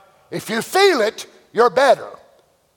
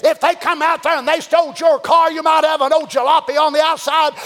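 ordinary talk

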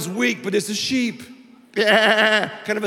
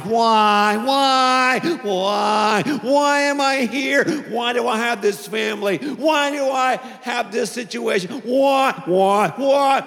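loud, high, building whiny wails of why; whining why; 3 semitones higher, 4 dB louder than usual. Shown as a demonstration. Preacher acts out a whining child's rising why. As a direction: wail why louder and higher each time, like a tantrum. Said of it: "I can't say whine without saying why".

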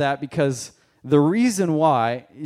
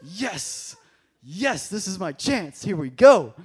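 inner excitement; 6 semitones higher, much slower than usual. Retold or imitated. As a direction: hold back glee then let it burst. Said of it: "But inside I was like".

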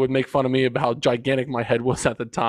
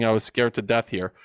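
ordinary talk, nothing marked

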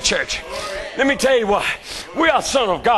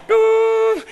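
imitated machine beep; 11 semitones higher than usual. Preacher imitating a hospital machine to the church, high beep. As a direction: mimic the hospital machine crying out. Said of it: "the machine going".

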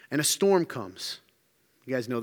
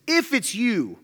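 higher challenge from bullheaded Peter; questioning challenge; 6 semitones higher, slower than usual. Acted. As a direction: throw it out as a bullheaded dare. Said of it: "he's always questioning Jesus".

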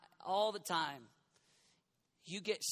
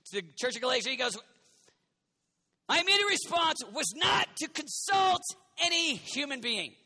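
loud, higher full-force preaching; 9 semitones higher, 8 dB louder than usual. Acted. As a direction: retell Paul with fire. Preaching Paul's story.

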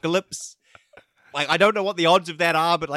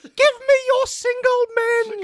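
shrieked, much higher and louder monster demand; Candy monster voice; 5 dB louder than usual. Acted. Candy breaking into the bunker, monstrous high shriek. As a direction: screech it like a man-hungry monster.

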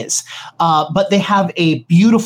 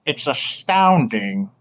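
pale host; 5 semitones lower, 5 dB quieter, slower than usual. Acted. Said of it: "he looks at you and goes".

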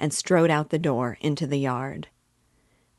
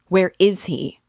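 urgent demand; 4 semitones higher, 7 dB louder than usual. Acted. Father shaking Weir.

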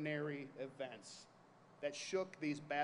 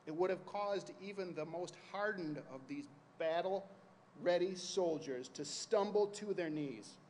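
ordinary talk, nothing marked

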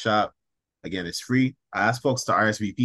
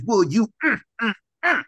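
savoring hum; 9 semitones higher, faster than usual. Acted. Poet savoring an imagined dish, high pleased mm. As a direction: hum like a mouthful of good food.

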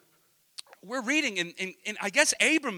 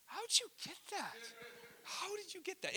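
high, soft incredulous question; hushed high-pitched astonishment; amazed questioning; 10 semitones higher, 16 dB quieter than usual. Retold or imitated. Father marvelling at what his five-year-old noticed, amazed.

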